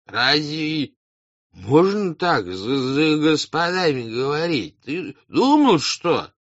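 put-on high, slow, stumbling drunken reproach; boozy high-voiced scolding. A narrator's reading. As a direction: stumble over words and draw them out. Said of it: "Два пьяные мужика".

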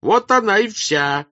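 loud, very high peasant harangue; high, forceful crowd shout. A narrator's reading. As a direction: yell it out like orders thrown back in reproach. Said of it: "кричал другой".

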